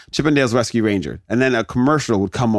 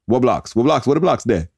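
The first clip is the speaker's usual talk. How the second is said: rough attempt at Jamaican patois; puzzled parent in a mock Jamaican accent. Jamaican parents questioning their kid, bad patois accent. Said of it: "My parents are Jamaican".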